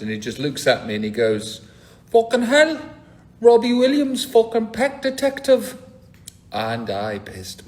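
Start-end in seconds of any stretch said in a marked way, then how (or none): 2.1-5.8 high, loud Geordie shout of surprise; Geordie accent. Retold or imitated. Geordie man at the urinal shouting in a Geordie accent. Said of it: "he was a Geordie".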